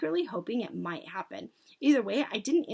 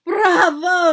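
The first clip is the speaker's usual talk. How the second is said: high, loud cheer; ringing crowd acclaim; yelling audience; 8 semitones higher, 14 dB louder than usual. A narrator's reading. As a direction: cry it out in jubilation. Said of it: "yelled the audience".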